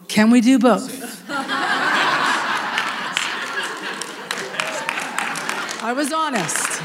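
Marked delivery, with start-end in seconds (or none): 0.1-1.3 slow, laughing question; a laughing, hopeful plea. Retold or imitated. Preacher asking God with a laugh, laughing. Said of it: "And I was like". 1.3-5.8 laugh. Real, not acted. Congregation moment, preacher laughs.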